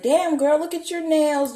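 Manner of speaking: high, loud mock admiration; loud, excited re-enacted compliment; re-enacted gushing. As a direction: gush it way up high, like a giddy flashback. Acting out her own gushing then.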